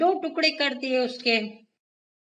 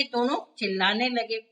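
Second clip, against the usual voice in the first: ordinary delivery, nothing marked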